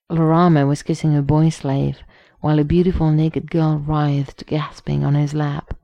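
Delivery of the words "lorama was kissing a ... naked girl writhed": ordinary delivery, nothing marked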